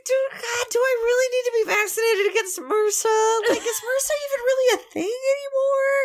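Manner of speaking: high whiny sobbing plea; mock crying plea. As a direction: wail and beg like a big baby. Playing patient getting shot.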